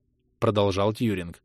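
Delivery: usual speaking voice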